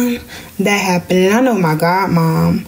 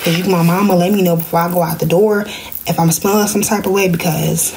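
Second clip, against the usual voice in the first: ordinary delivery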